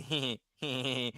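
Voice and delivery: soft imitated hee-hee giggle; sheepish giggle. Man behind the woman at the door, sheepish little giggle. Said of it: "sheepishly going".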